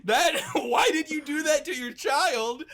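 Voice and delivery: loud, high mock dismay; shocked reproach. Sister challenging mom over her cruel words, loud mock reproach. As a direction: cry out in hammed-up disbelief.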